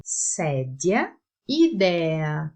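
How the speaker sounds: "Sedia, idea" with open E, stress before the end; open E examples. Shown as a demonstration. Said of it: "Outros dois exemplos". (E aberto em "Sedia, idea")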